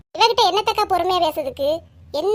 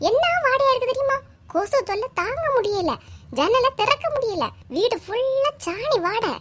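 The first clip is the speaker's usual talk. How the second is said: angry complaint; 7 semitones higher than usual. Acted. Geetha complains angrily.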